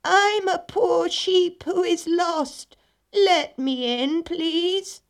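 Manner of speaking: high, slow, drawn-out fake sheep voice; wolf disguised as sheep. A narrator's reading. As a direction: plead as a wolf pretending to be a lost sheep. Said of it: "in a disguised voice cried".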